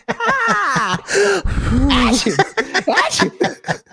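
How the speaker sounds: high, hammed-up sneezing fits; wife's face in the hair, exaggerated high sneezes; mock sneezes. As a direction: fake a big sneeze, then another, laughing. Mimicking hair making her sneeze.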